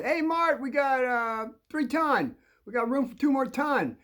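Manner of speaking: high, hearty call of a delivery man; coal delivery guy. Retold or imitated. Coal delivery man calling out to Dad, high and hearty.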